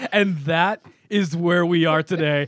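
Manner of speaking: laughing while talking; words bubbling with laughter. Real, not acted. Laughing through the punchline.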